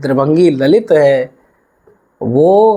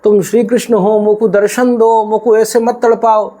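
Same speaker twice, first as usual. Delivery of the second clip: 1 pleading devotee; 6 semitones higher than usual. Acted. Old devotee begging Krishna.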